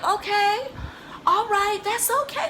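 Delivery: very high, squeaky reassurance; hammed-up tearful comfort; mock-weepy consoling. Mom consoles crying daughter.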